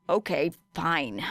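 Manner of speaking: grudging huffed okay fine. A narrator's reading. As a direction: give in with a huff. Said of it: "said Shinso with a huff".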